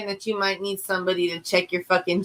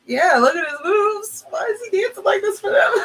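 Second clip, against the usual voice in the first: higher, teasing disbelief building into a laughing shout; mock incredulous; 10 semitones higher than usual. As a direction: poke fun at the dancing. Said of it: "And I was like".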